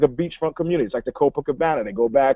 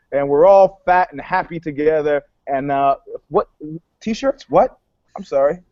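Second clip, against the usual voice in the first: ordinary talk, nothing marked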